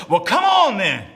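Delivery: loud battle cry; rallying shout. Acted. Jonathan answers his armor bearer, rallying shout. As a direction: shout it like a call to charge. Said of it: "Just shout".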